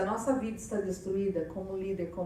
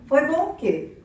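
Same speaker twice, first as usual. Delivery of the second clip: louder, sarcastic snap; irritated wife retort; 2 semitones higher, 7 dB louder than usual. Retold or imitated. Wife with PMS snaps back at her husband, sarcastic and louder. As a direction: throw his words back at him.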